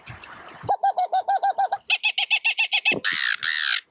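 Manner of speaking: high shrill whistled monkey chatter; piercing whistled monkey cry; monkey whistle squeal. Shown as a demonstration. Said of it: "And with the monkey".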